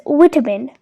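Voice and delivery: "vitamin" with a short i, VIT-uh-min; British pronunciation. Shown as a demonstration. Teacher giving two variants, "vitamin" British with short i. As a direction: say "vitamin" the British way, first syllable like "wit". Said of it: "In British English, it is pronounced".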